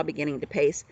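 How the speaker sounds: ordinary delivery, nothing marked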